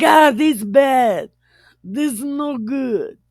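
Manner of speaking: high, slowed mock dismay; hammed-up simpleton disapproval; broken-English scolder. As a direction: moan it in comic pidgin. Caveman-like paraphrase of father-in-law.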